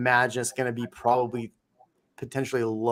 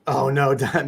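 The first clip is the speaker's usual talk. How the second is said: normal talking voice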